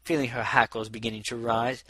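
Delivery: ordinary delivery, nothing marked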